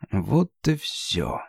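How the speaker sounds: quiet slow inner voice; hushed, slow, giving up; resigned inner thought. Acted. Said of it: "мелькнуло в голове у Сонда".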